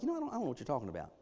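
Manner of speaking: casual brush-off. Retold or imitated. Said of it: "the volume gets turned up".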